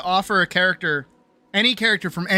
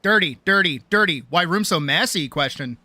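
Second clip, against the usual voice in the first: normal talking voice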